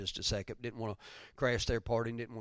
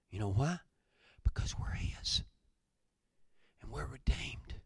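whispered. Real, not acted. Preacher drops to intimate aside.

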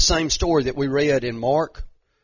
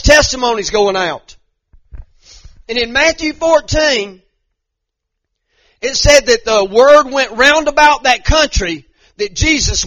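much higher, louder revival cry; preaching at full force; 7 semitones higher, 11 dB louder than usual. Acted. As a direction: shout the testimony out.

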